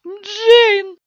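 very loud, piercing high scream of the name; shrieking. A narrator's reading. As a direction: scream out after a lost child. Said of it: "взвизгнула Констанс". (оглушительный высокий визг)

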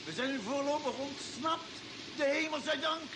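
breathless thankful relief; relieved excitement. Acted. Pursuers give up, crew escapes.

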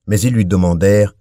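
ordinary delivery, nothing marked

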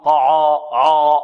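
"طعا. عا." with a rounded, backed vowel after ع; wrongly heavy ع. Shown as a demonstration. Teacher shows the faulty reading, heavy dark ع in "طعا". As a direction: thicken both syllables of "طعا". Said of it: "خطأ".

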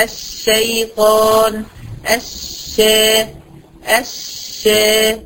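slow, long-held "shsh" in الشيطان and الشا; doubled shin. Shown as a demonstration. Teacher showing the two shins inside a shadda, drawn-out "sh" in الشيطان. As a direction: stretch the first silent ش, then open into "sha". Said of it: "هتبقى شين ساكنة وشين مفتوحة".

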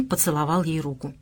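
plain reading voice

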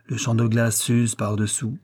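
ordinary delivery

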